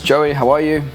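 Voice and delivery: usual speaking voice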